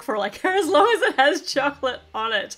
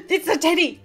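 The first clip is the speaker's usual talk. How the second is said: gasp, then higher excited discovery; delighted surprise; 7 semitones higher than usual. Real, not acted. Toy reviewer spotting the teddy, delighted surprise.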